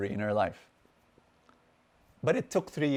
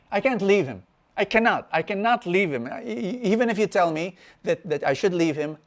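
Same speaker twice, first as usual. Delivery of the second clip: higher, louder mock-distressed pleading; retold tearful protest; 6 semitones higher, 4 dB louder than usual. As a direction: plead insistently, imitating an upset woman. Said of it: "she starts crying again".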